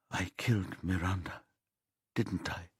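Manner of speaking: a near-whisper, barely voiced. A narrator's reading. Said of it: "Vicky whispers".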